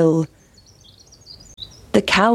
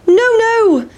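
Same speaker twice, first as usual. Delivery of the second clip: very high, sing-out refusal; defiant pancake; 13 semitones higher, 4 dB louder than usual. Acted. Pancake to hungry boys, defiant.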